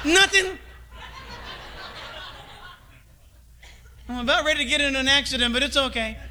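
high laughing fake okay; half-laughing sheepish answer; laughing hurt reply. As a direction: brush it off through a laugh. Playing the rejected child.

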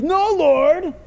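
loud, high protest; mock defiant refusal. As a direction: blurt out a big refusal.

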